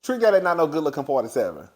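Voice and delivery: usual speaking voice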